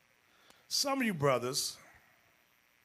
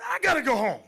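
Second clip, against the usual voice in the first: higher, louder, near tears; husband rushing out of class, crying out; panicked husband; 7 semitones higher, 5 dB louder, faster than usual. Retold or imitated. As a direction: blurt it out in a panic. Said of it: "He's like".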